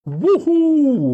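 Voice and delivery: high, loud joyous whoop; excited whoop. A narrator's reading. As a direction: let out a wild cheer of delight. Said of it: "fece Dean". (urlo di gioia acuto e forte)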